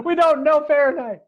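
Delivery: high, loud mock confession; hammed-up loud, high outburst; caught-out tourists.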